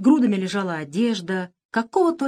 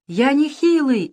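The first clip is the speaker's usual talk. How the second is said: high, loud, slow offended yell; indignant shout; 8 semitones higher, 6 dB louder, slower than usual. A narrator's reading. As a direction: protest hotly, stung. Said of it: "закричал Крыс".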